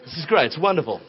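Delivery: low, politely conversational mock baby; mock grown-up baby. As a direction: play a baby talking like a small adult. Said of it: "Babies just don't sit up".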